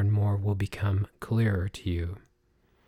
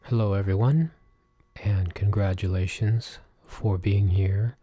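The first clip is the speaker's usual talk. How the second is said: usual speaking voice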